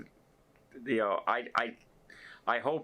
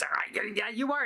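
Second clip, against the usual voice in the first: higher, eager yes; excited crew man; 12 semitones higher than usual. Retold or imitated. Photo worker confirming to the vlogger, excited and higher. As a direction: blurt it out, high and thrilled.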